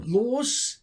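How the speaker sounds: "los" with a strange stretched vowel; puzzled echo of a mispronounced "los"; imitated weird pronunciation. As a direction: sound out "los" oddly, in disbelief. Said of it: "no one says that".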